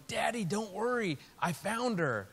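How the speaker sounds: put-on bright eager kid calling out; a hint of an excited little daughter; child's voice. Pastor voicing his young daughter.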